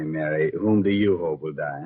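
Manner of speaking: ordinary delivery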